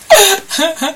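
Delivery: quick high laugh; short laugh. Real, not acted. Laughing at her own story.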